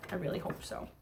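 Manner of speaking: softly whispered hope. Real, not acted. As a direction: breathe it in a hushed whisper. Teacher answers her own question.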